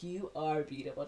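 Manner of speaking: lightly imitated awe; stunned admiration. Date sees her at the door, mildly stunned. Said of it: "super stunned".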